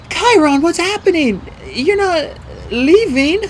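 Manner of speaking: put-on high, anxious girl's voice; high-pitched, shaky and distressed. A narrator's reading. Girl fears her mentor is leaving, shaky voice. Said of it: "Her voice was shaky".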